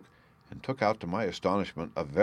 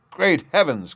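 startled cry, higher and louder; cried out; 8 semitones higher, 7 dB louder than usual. Acted. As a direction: inspector crying out at the unmasked prisoner. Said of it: "cried the inspector".

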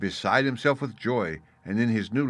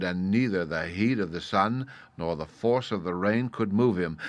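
plain reading voice

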